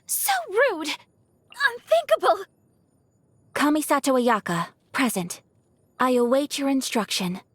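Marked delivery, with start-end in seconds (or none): 0.1-2.5 high-pitched affronted outcry; shocked indignation. Acted. Lady fighter hit in combat, high shocked outcry. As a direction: cry out high, as if just struck.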